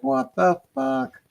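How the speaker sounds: high, drawn-out disbelief; retold bewilderment. Investigator realising it wasn't his teammate, high, slow bewilderment. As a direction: stretch each word out high, dumbfounded.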